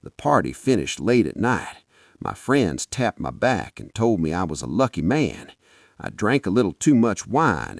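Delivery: plain reading voice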